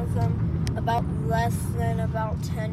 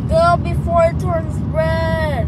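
loud, higher urging; urgent call; 5 semitones higher, 8 dB louder than usual. Real, not acted. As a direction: push them on before the light turns. Urging at the traffic light.

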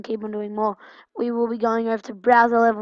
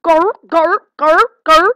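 loud, high urging chant; excited cheering; 6 semitones higher, 9 dB louder than usual. Real, not acted. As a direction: push the character on, loud. Cheering game character on.

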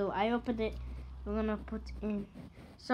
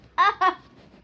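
burst of laughter; 9 semitones higher, 11 dB louder than usual. Real, not acted. Laughing at sour taste.

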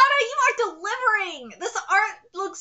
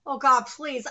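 normal talking voice

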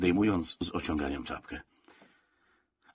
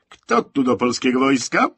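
loud, raised hail; ringing shouted question; calling out; 5 semitones higher, 9 dB louder than usual. A narrator's reading. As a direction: call out to a carriage of sleepers. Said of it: "zawołał Rześko".

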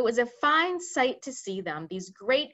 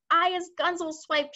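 higher giant shout; 8 semitones higher, 3 dB louder than usual. Acted. Meatdripper shouting in his chains, higher. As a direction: shout it like an angry brute. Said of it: "shouted the meat dripper".

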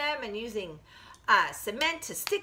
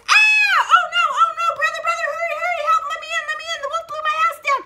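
shrieking, very high and loud, words tumbling; panicked piggy; 9 dB louder, much faster than usual. Acted. Piggy flees to brother's house, shrieking in panic. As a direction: squeal for help in a frantic rush. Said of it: "and the piggy says".

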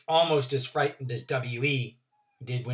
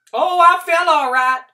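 put-on old country woman; 14 dB louder than usual. Trotter voice.

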